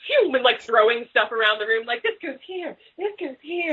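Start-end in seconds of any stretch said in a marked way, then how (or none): 2.0-3.7 higher, softer sing-out; lightly tossed off in a high, softer voice; playful acted gesture talk. Acting out future-style room tossing.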